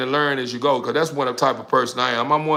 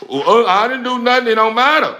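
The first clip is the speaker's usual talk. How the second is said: mock excuse; 9 semitones higher, 5 dB louder than usual. Voices the dogs' excuse mid-scolding.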